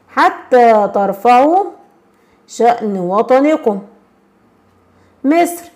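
slow, measured reading of a teacher's address; solemn classroom reading, words spaced out; formal recitation. Shown as a demonstration. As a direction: read it out like a proud formal speech. Teacher reads quoted speech aloud.

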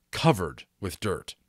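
ordinary delivery

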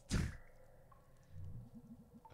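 high, loud breathy laugh; laughter. Real, not acted. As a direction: crack up at the joke. Laughing at dolphins joke.

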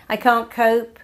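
"I can't cope" blurted high and loud; a loud, high, frantic complaint; panicked "can't cope". Shown as a demonstration. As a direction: cry it out like you're overwhelmed. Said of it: "You get to choose".

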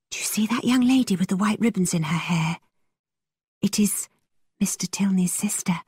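hushed, urgent aside; urgent whisper. A narrator's reading. Catherine pulls Isabella away from James, whispering urgently. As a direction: whisper it as a secret. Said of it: "whispered urgently".

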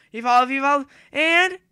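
urgent excited chant; excited yell. Real, not acted. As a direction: yell evolve, evolve at the screen. Urging character to evolve.

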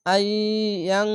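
আই ইয়াং with a held, hidden nasal n; ikhfa ghunna. Shown as a demonstration. Teacher showing ikhfa in the word, nasal hum on ইয়াং. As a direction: let the end of ইয়াং hum through the nose. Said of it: "ইকফা গুন্না হবে".

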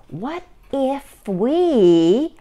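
high, very drawn-out suggestion; dawning idea. A narrator's reading. Ava floats an idea to her mother, slow expectant lilt. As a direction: stretch it out, hanging unfinished. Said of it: "Ava started".

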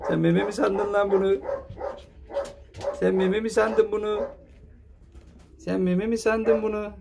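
playful high voice to the pup sucking his hand; high teasing pet talk. Real, not acted.

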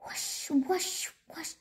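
soft, breathy wingbeats; imitated whooshing. A narrator's reading. Narrator imitates the strange sound returning, soft whooshes. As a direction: repeat a hushed rush of air. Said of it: "He heard the sound again".

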